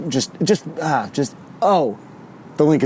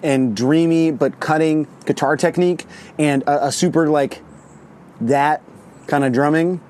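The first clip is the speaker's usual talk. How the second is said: normal talking voice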